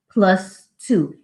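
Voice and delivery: ordinary delivery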